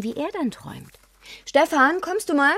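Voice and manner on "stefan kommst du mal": loud, high call across the house. Acted. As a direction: call to the next room. Ruft Mann aus anderem Zimmer. (lautes Rufen durchs Haus)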